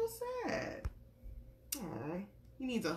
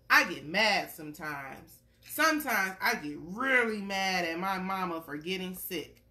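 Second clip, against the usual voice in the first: gentle storybook read-aloud; reading to a child; 2 semitones higher, 6 dB louder than usual. Acted. Parent reading a picture book to a little one, gentle storytelling.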